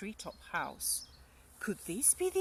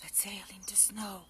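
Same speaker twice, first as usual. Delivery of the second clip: hushed whisper to herself; 7 dB quieter, faster than usual. Acted. Gruffalo's child whispers over a tail in the snow. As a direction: whisper it so nobody hears.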